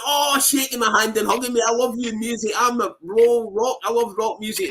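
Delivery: high, gushing fan voice; big German fan. Retold or imitated. Big German fan hugging him, gushing and high. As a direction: gush like a starstruck rocker.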